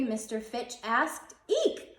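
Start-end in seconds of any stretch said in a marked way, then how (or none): 1.5-2.0 very high startled eek; high squeak. A narrator's reading. Startled hamster squeaking at the librarian, very high. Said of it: "I squeaked".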